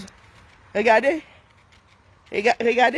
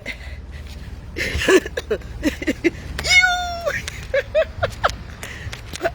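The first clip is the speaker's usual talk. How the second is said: long airy high laugh; long breathy laugh. Real, not acted. Long rire soufflé. (long rire soufflé)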